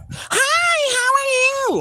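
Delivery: piercing high-pitched squeal of a greeting; squeaky Miss Piggy. Retold or imitated. Grandma imitating son's partner on first call, squeaky high voice. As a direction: mimic an annoying, squeaky woman on the phone. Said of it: "that squeaky, like, kind of a deeper sounding Miss Piggy".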